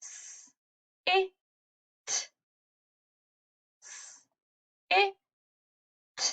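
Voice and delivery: "S, i, t" twice, very soft and separate; softly segment "S, i, t"; quiet sounding out. Shown as a demonstration. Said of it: "listen for the last sound".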